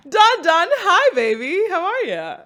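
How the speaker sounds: high, loud sweet-talking coo; cutesy baby talk. Pretend. Greeting co-host Donald, a high cutesy coo. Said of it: "calling you baby is disgusting".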